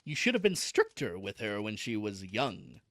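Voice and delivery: lightly voiced low uncle; reproving uncle. A narrator's reading. Uncle blaming mother for her upbringing, low reproof.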